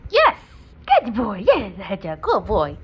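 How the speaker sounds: loud, drawn-out good-dog voice; gushing dog praise. Real, not acted. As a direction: heap loud praise on your dog, pitch swooping up.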